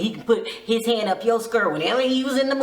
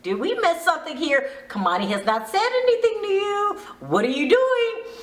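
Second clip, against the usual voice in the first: higher, incredulous put-on voice; a hint of stunned disbelief; mock baffled; 8 semitones higher than usual. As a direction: play the confused friends in the car. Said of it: "everybody in the car's looking at her like".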